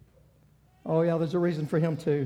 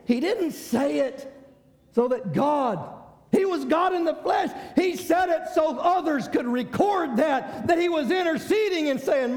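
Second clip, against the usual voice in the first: full-force preaching; 9 semitones higher than usual. Acted. Pastor to congregation, impassioned preaching. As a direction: preach it out with fire.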